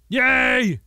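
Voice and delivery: high, loud little cheer; triumphant cheer. Real, not acted. Player celebrating the fire being gone, triumphant cheer. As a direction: shout it in victory.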